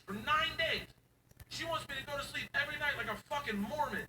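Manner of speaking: high, fast disbelief; incredulous. Real, not acted. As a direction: rant in disbelief. Incredulous rant.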